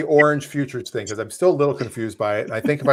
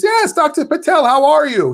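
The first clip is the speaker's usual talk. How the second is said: high, loud, eager greeting; eager salesman; 14 semitones higher, 5 dB louder than usual. Retold or imitated. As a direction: gush a high, delighted hello. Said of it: "He goes".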